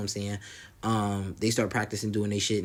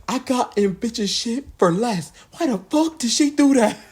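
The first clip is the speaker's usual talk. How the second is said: very high, loud imitation of outrage; mock furious outburst; 13 semitones higher, 6 dB louder, slower than usual. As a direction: act out her anger, hammed up. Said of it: "She was so mad".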